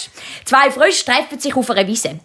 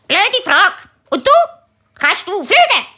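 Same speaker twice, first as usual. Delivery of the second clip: higher, snippy parrot voice; cheeky parrot; 9 semitones higher than usual. Acted. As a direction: talk back like a sassy bird.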